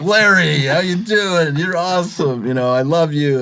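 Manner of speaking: high, gushing praise; excited fan greeting. Retold or imitated. Driver greets Larry Fitzgerald at the bank, high and gushing. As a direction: gush over your hero.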